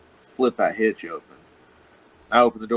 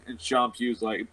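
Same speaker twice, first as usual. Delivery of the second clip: ordinary talk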